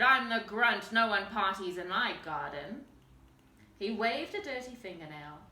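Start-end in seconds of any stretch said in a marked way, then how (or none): 0.0-2.9 much louder troll bellow; angry troll, loud bellow. A narrator's reading. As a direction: bellow it to scare the visitor off. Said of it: "he bellowed".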